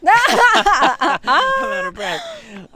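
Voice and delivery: breathless laugh, high and loud. Real, not acted. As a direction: laugh it out, very slowly.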